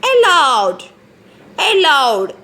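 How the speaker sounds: Aloud said slowly and loudly; careful pronunciation. Shown as a demonstration. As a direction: model the word for children. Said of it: "how we should pronounce now".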